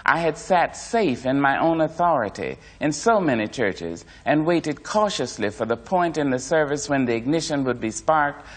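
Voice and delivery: ordinary delivery, nothing marked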